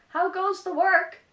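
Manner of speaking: high, loud calling out from bed; lazy farmer calling. Acted. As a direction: bellow the question up from bed. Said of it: "called the farmer".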